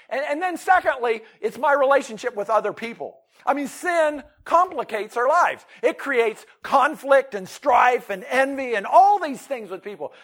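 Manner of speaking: loud, high preaching voice that slows on the last line; full-force preaching. Acted. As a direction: drive each point home from the pulpit. Preaching loudly on sin.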